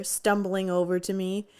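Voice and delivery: ordinary talk, nothing marked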